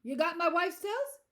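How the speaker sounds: loud, high, alert question; bright, alert. A narrator's reading. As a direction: ask it wide awake, at a normal pace. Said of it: "suddenly bright alert".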